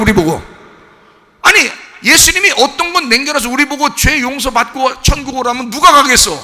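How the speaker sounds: high, loud, protesting sermon voice; impassioned preaching. Real, not acted. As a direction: challenge the congregation at full force. Preaches at full force.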